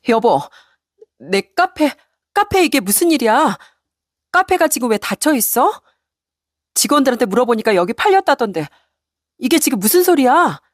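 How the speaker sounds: high, alarmed wife's voice; panicked wife. Acted. Wife finding her cafe sold, panicked and high. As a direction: voice the wife shrill with shock and panic.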